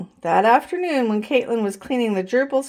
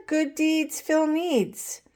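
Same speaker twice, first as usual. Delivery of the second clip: slow motto; 6 semitones higher, 4 dB quieter, slower than usual. A narrator's reading.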